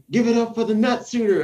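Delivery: high, hyped announcer-style sergeant; rally the unit to applaud; imitating first sergeant. First sergeant hypes the whole unit to clap, high and loud. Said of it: "He was like".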